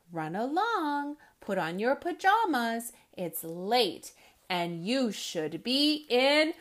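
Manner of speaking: put-on animated children's book reading, loud punchlines; lively read-aloud. A narrator's reading. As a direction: read it with big comic energy for kids. Reads picture book aloud.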